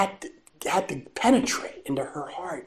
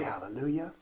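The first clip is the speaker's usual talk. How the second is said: ordinary talk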